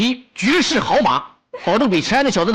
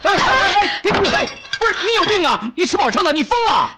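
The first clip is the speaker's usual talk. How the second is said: high, agitated telling-off; alarmed scolding; 10 semitones higher than usual. Acted. Friend stopping a reckless outburst, high alarmed scolding. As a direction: scold in sheer disbelief.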